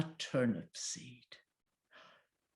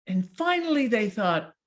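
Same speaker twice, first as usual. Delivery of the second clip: ordinary delivery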